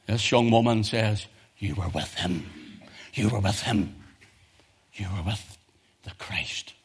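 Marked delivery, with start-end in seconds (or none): 1.6-6.7 hushed accusation. Retold or imitated. Young woman accusing Peter, hushed.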